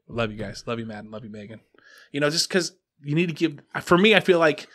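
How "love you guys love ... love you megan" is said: much softer and lower, hushed and loving; tender. Retold or imitated. Dad tells his sleepy kids he loves them, hushed and tender.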